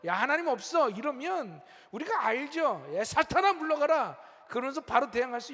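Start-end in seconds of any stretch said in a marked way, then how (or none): 0.1-0.9 mildly raised, blunt taunt; acting out a brazen challenge to faith; louder mock denial in Satan's role. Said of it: "예전처럼". 3.0-4.2 loud, raised-pitch command; acting out driving the devil away; forceful, high banishing call; commanding rebuke. Acted rebuke casting Satan away.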